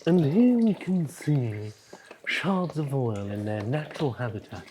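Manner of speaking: lightly hushed nature documentary narrator; David Attenborough impression. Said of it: "David Attenborough".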